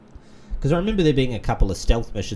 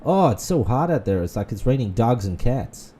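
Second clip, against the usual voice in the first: put-on American accent; 3 semitones higher, 3 dB louder than usual. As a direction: imitate a German faking an American accent. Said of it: "he's putting on an American accent".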